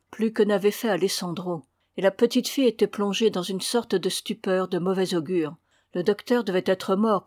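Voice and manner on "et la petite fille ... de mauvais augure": ordinary delivery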